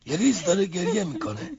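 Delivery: rising into a loud, tearful complaint; upset complaint. Acted. Wife to husband, complaining loudly about his cruelty.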